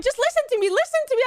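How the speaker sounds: high, urgent plea; retold frantic pleading. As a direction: beg them to listen. Retold pleading with medical staff.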